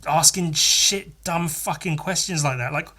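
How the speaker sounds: usual speaking voice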